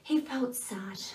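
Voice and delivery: sad whisper; whispered sadness. A narrator's reading. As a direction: breathe it out sadly. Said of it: "began to cry".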